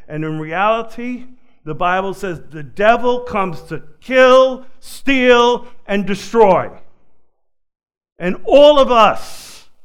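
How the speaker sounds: loud rising preacher's call against the devil; impassioned sermon delivery; fiery preaching. Acted. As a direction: build it up from the pulpit. Preaching at full force.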